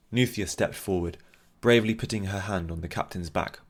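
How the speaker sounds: usual speaking voice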